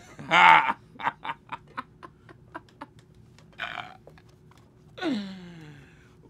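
long loud laugh; long real laugh. Real, not acted. Rapper reading slang definitions, laughing hard.

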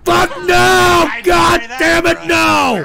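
high, loud, drawn-out howl of refusal; enraged scream. Real, not acted. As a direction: scream each word in fury. Host screams in frustration.

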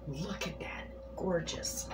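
ordinary talk, nothing marked

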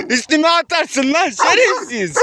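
laughing curse. Real, not acted. Fearful joking curse at dog.

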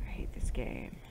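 intense whisper that bursts out on the last word; scared gamer whispering her frustration; whispered complaint. Real, not acted. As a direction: hiss it in frustration, then blurt much. Whispered complaint then outburst.

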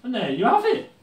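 high, loud showman's ta-da; mock triumphant reveal. Vlogger finishes the bed for the camera, mock triumphant and loud. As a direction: announce it like a game show host.

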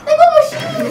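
high, loud alarmed yell; panicked shout. Real, not acted. Panic at game enemies.

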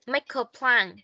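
"Make a plan" linked as "ma-ka plan"; linked words. Shown as a demonstration. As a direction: let the k run into a. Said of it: "Cái này có thể nối được này". (nối âm "Make a" thành "ma-ka")

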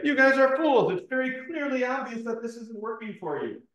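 high-pitched exasperated telling-off; mock scolding. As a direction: pretend rebuke, pitched well up. Mock rebuke of Baal's worshippers.

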